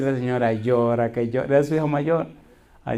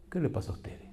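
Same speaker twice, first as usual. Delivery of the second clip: whisper; 4 semitones higher, 9 dB quieter than usual. Retold or imitated. Retold scolding of guests.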